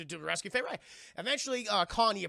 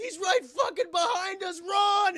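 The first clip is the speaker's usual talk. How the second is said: high, loud frantic warning; panicked shout; 13 semitones higher, 9 dB louder, slower than usual. Retold or imitated. Jack runs from the jungle warning the crew, panicked mock shout. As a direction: yell a hammed-up alarm to flee.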